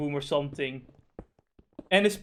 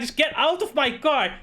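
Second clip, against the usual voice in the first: higher, slow, forceful order; mock angry driver; 9 semitones higher, 3 dB louder than usual. Driver ordering Karen out of his car, mock angry. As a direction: demand she leave the car, dragging it out. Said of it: "he's like".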